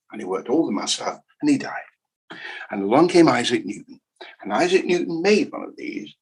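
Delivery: ordinary delivery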